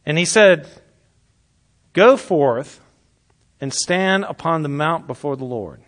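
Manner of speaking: loud measured proclamation; scripture reading. Acted. Preacher reading Elijah's story aloud, declaiming. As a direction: declaim each line with weight.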